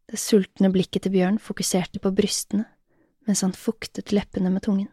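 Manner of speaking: ordinary delivery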